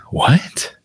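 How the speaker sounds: a breathy, voiceless what; family staring at each other, whispered disbelief; baffled whisper. Retold or imitated. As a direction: whisper it in stunned confusion. All three stare, baffled.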